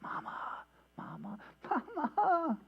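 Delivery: soft then rising high panicked calls; imitated child's cry. Preacher re-enacting son's frightened calls, building panic. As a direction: mimic a scared kid calling mom. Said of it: "complete horrific terror".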